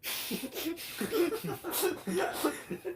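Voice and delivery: high loud burst of laughter. Real, not acted. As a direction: crack up hard.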